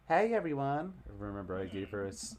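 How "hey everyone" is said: put-on southern accent. Newcomer greeting the party in a Southern accent. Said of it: "a weird southern voice".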